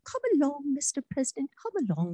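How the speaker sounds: high, brisk mock Queen urging him on; imitating the Queen. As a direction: hurry him along like a brisk royal hostess. Queen hurrying the President along.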